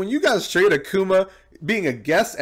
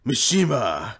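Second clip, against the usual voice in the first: lower, menacing Akuma line, imitated; mock ominous threat, lower; lower villain voice; 6 semitones lower than usual. Quoting the villain threatening Mishima, lower mock menace.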